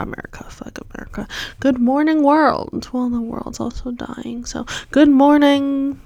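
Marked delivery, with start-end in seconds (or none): 1.6-2.9 loud, high, cheery good morning; excited greeting. Pretend. Greeting the day again. 4.9-6.0 drawn-out greeting. Pretend.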